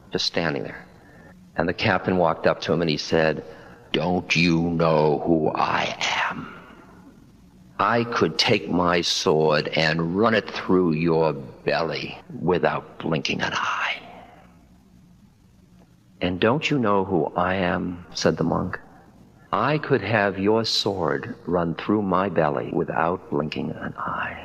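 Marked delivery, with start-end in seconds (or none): 3.9-14.0 slow whispered threat, drawn out; a cruel captain threatening in a hushed slow voice; menacing captain. Acted. 16.2-24.4 slow, steady answer that fades to a whisper; an unshaken monk calmly matching the threat. Acted.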